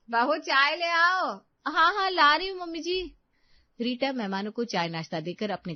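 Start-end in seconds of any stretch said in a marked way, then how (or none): 0.1-1.2 high, loud, drawn-out summons; calling out. Acted. Calling for tea across house. 1.6-3.0 high, raised-voice reply; calling back. Acted. Answering the call from kitchen.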